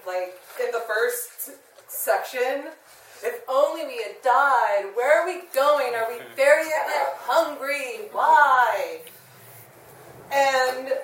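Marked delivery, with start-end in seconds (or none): 3.2-9.0 put-on loud, high whiny kid in the back seat; bored whining child. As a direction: whine like a fed-up child on a long drive. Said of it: "acting like kids on a road trip".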